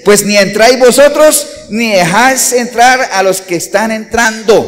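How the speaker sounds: loud, slow pulpit reading of the woes; thundering sermon reading; preaching at full force. Acted. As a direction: proclaim it to the whole church, slowly. Reads scripture at full force.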